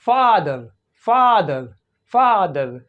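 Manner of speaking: फादर with a long, open, unrounded AH. Shown as a demonstration. Teacher giving a model word for American O, long open AH in फादर. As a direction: open the mouth on the first vowel of फादर. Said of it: "वो आ होता है और longer".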